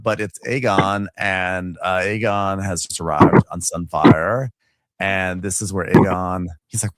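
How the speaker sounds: usual speaking voice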